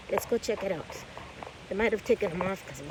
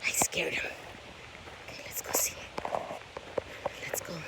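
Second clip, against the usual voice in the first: whispering; 4 semitones lower, 9 dB quieter than usual. Real, not acted. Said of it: "stay quiet".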